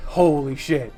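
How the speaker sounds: usual speaking voice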